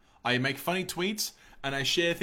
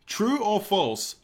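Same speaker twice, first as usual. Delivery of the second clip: slow drawn-out announcer read; game show announcer; 4 semitones higher, 3 dB louder, much slower than usual. Acted. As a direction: announce like a quiz host. Host drawn-out quiz announcement.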